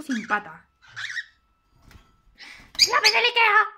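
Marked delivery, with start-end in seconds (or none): 2.9-3.7 higher and louder shout of the name; playful cheerful callout; excited call. Acted. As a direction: call out across the room. Calling out to the bird.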